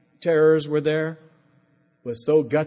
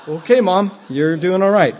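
louder, laughing through the words; 5 semitones higher, 9 dB louder than usual. Retold or imitated. Son laughing at his mother's witty comeback. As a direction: chuckle at her sharp reply. Laughing reply to mom's quip.

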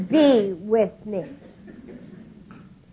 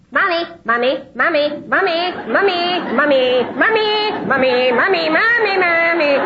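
put-on a child's nagging mommy, rising higher; whiny little boy; 5 semitones higher than usual. Four-year-old pestering his mother, lightly voiced whiny chant.

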